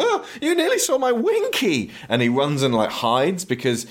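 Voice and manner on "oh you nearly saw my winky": very high, comic modesty panic; hammed-up scandalised squeak; mock embarrassed squeal. Tom caught naked by the woman, mock embarrassed squeal.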